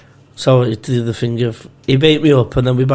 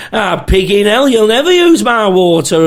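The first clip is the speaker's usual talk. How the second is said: high, mock scolding old woman; 7 semitones higher, 4 dB louder than usual. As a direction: imitate a cross old lady, pitched up high. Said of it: "This old woman's like".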